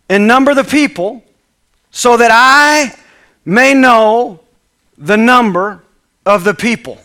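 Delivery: slow, loud, high royal order; king's command. Acted. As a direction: command like a king.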